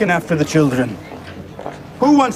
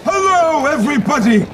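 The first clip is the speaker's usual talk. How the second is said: showman greeting; 10 semitones higher, 3 dB louder than usual. Acted.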